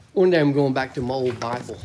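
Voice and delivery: normal talking voice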